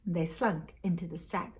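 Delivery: ordinary delivery, nothing marked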